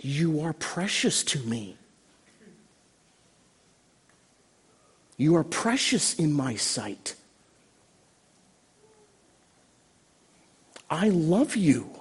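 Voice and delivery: soft, tender voice of Jesus; hushed, acted tenderness; compassionate. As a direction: reassure an outcast gently, pausing between phrases. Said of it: "he shows compassion".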